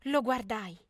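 ordinary delivery

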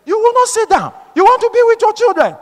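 high, incredulous retort; indignant rebuke. Retold or imitated. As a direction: throw the plea back at the sister, outraged. Throws her plea back in rebuke.

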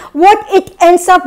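ordinary delivery

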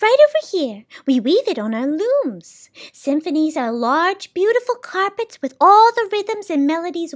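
high, lilting list that drops at the end; soundkeeper, enthusiastic. Acted. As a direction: show off the wares with rising delight. Soundkeeper shows off music.

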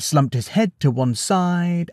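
usual speaking voice

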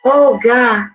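normal talking voice

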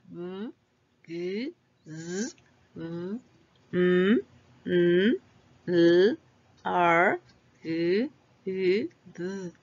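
each consonant hummed on its own: "Mm, g, z"; isolated voiced consonants. Shown as a demonstration. Teacher has pupils touch their throats, voiced consonant sounds. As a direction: buzz each sound "Mm, g, z" briefly in the throat, with pauses. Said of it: "son los sonidos de esas consonantes".

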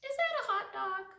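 high, quick little voice; a small bird peering up eagerly; curious duckling. Acted. The duckling asking the pigeon about his food, high and quick.

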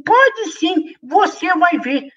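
very high squeaky little voice; tiny mosquito voice. Acted. Tiny mosquito comforting the bunny, high squeak. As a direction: speak like a tiny bug.

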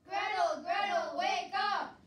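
loud urgent call. Acted. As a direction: shout to wake her up. Calling to wake fainted Gretel.

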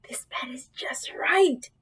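slow, contented whisper; sleepy whisper. A narrator's reading. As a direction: whisper it slowly as if sinking into bed. Goldilocks settles drowsily into bed.

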